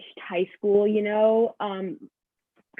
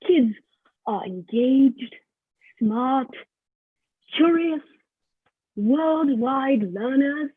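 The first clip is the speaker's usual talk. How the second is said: put-on slow British accent, word by word; 3 semitones higher, 3 dB louder, much slower than usual. College listing its ideal students, British accent. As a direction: proclaim each quality in mock British grandeur. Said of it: "we're just gonna go with it".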